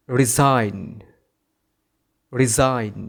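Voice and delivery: "resign" said ri-ZAIN; silent G. Shown as a demonstration. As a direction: leave the g out of "resign". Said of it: "এর পরের শব্দটার সঠিক উচ্চারণ হবে".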